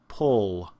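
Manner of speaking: "pull" with the vowel of book; low, careful isolated "pull"; horseshoe vowel. Shown as a demonstration. Teacher gives an example word, clear book vowel in "pull". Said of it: "this is the sound in".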